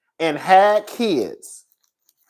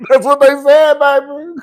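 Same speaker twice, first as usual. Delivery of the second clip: laughing shout; 4 semitones higher than usual. Real, not acted. Laughing loud retelling.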